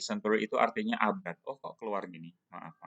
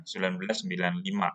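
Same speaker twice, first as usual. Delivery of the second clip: usual speaking voice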